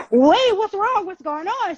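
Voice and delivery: high, urgent questioning; retold alarm. Asking a tearful woman what's wrong, alarmed.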